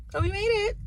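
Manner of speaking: high, bright burst of relief; excited relief. Real, not acted. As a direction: cheer it out, relieved. Relieved arrival after tough drive.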